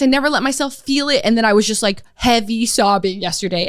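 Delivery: normal talking voice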